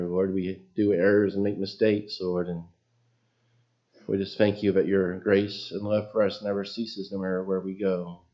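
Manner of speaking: usual speaking voice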